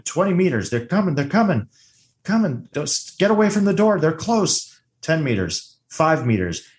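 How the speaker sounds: high, urgent countdown, mildly panicked; mock panic. Co-host re-enacting the motion tracker scene, mock panic. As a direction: act out rising alarm in a high voice.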